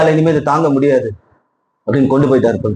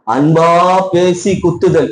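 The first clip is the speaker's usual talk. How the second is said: slow definition; 3 semitones higher, slower than usual. Shown as a demonstration. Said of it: "அவிலானா என்ன அர்த்தமா?".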